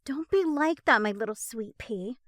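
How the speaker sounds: sing-song playful taunt; teasing. A narrator's reading. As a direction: tease him sweetly. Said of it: "Becca teases".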